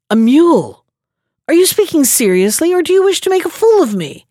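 high, quickening sly voice; mock disbelieving rogue. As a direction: needle him as if he's joking. Third rogue feigns disbelief.

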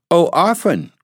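high, eager exclamation; little girl's cry. A narrator's reading. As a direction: pipe up eagerly as a little girl. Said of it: "cried the little girl".

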